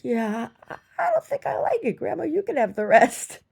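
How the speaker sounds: put-on very high, loud child voice; reluctant little girl. Little girl declining Grandma's egg, high and squeaky. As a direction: squeak out a polite refusal.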